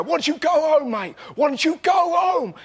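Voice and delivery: high shouted heckle; shouting at a man on a train to go home; aggressive shout. Retold or imitated. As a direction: yell it at a stranger on a train. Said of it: "just go".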